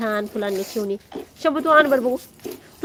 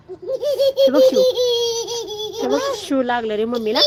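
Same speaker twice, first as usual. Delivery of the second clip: laughing; 11 semitones higher, 4 dB louder than usual. Real, not acted. Mother laughing as she shows her son she got hurt too. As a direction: giggle through the words.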